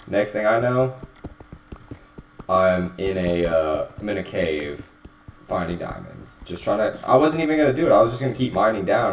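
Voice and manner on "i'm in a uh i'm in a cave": usual speaking voice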